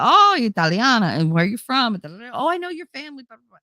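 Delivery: high, chummy recognition; friendly cop. Retold or imitated. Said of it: "they're just like".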